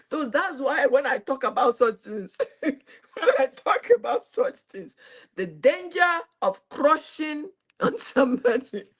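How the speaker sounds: high, giggly delivery; words breaking up into laughs; laughing while talking. Real, not acted. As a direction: talk through real laughter. Laughs while warning about crushing.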